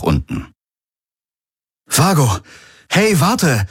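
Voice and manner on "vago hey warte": high shout after someone; urgent high call. Acted. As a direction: call him back. Calling after departing drone.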